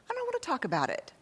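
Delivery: quick, high, sulky brush-off; mock-sulky refusal to her husband; petulant refusal. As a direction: snap it off like a cornered kid. Retold spat with her husband.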